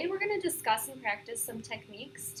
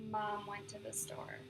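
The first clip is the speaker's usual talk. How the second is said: easy onset; 7 dB quieter than usual. Shown as a demonstration. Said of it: "add that slide at the beginning".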